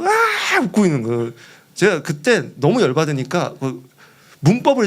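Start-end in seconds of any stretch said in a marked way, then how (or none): 0.0-0.6 very high mocking squeal; imitated laughing shriek. Prankster laughing at his soaked friend, mock laughing shriek. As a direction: squeal as if cracking up. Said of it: "막 이렇게 웃으면서".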